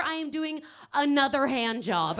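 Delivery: usual speaking voice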